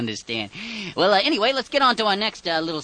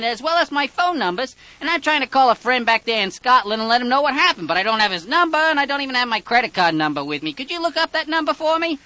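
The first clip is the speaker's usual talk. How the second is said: higher-pitched chirpy tourist caller; prank caller voice; 10 semitones higher, 4 dB louder than usual. Acted. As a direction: play a flustered visitor on the phone. Prank caller voicing a visitor.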